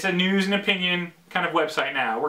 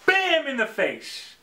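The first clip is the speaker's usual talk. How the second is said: high, drawn-out BAM; sudden impact; 10 semitones higher, slower than usual. Shown as a demonstration. Vlogger mimics the payoff after a slow build, sudden and punchy. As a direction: hit it like a surprise punch.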